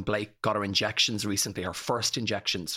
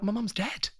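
higher, hushed dawning shock; stunned realisation; 10 semitones higher than usual. Retold or imitated. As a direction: let the truth land mid-breath. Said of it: "I went".

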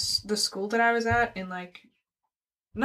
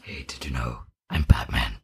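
much lower put-on superhero voice; Batman. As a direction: drop way down into a gruff hero growl. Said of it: "doing dumb voices".

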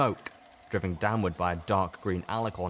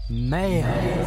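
higher, louder, eerie answer; chorus of voices; 10 semitones higher, 7 dB louder than usual. A narrator's reading. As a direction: answer as one hive voice. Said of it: "The voices responded".

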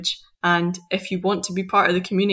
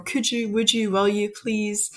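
polite English asking; 2 semitones higher, slower than usual. Shown as a demonstration. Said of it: "imperative in English is a lot more".

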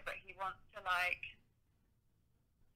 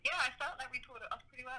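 normal talking voice

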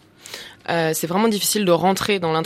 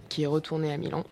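ordinary delivery

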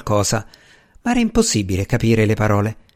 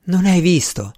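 exclaimed; 6 semitones higher than usual. A narrator's reading. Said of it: "esclamò Flora".

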